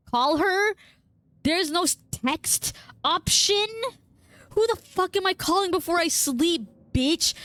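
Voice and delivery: high, outraged disbelief; indignant. Real, not acted. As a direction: protest like it's absurd. Outraged about calling.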